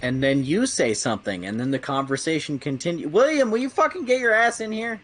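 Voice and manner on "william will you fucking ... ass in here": calling out. Real, not acted. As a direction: yell for William to get in here. Calling a friend into the call.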